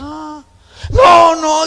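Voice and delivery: high, loud mock refusal; hammed-up indignant outburst; daughter's protest. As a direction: cry out like an upset daughter. Daughter arguing back, retold.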